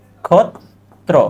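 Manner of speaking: নক্ষত্র in slow pieces, nok, khot, tro; syllable by syllable. Shown as a demonstration. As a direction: dictate নক্ষত্র with long pauses between syllables. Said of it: "এটা কি হবে?".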